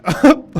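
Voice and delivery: sudden high burst of laughter; surprised laugh. Real, not acted. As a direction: blurt out a startled laugh. Sudden laugh at something on screen.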